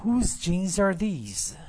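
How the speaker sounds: slow and loud, stressing Whose; teacher modelling. Shown as a demonstration. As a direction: model each word slowly for the class. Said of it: "لطفاً تکرار کنید".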